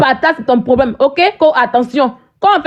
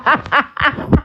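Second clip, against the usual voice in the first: mocking laugh; 4 dB quieter than usual. Real, not acted.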